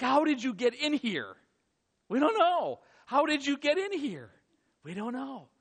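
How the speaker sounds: high, loud astonishment; bewildered back-and-forth; startled questioning. Acted. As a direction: ask in stunned amazement. Startled disciples question each other.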